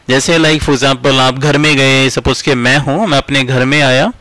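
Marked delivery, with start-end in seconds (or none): none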